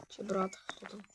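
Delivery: whispered aside. Real, not acted. Player whispers an aside while playing, whispered. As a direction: whisper it to yourself.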